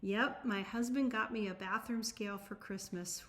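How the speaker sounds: ordinary talk, nothing marked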